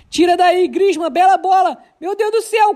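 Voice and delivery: ordinary talk, nothing marked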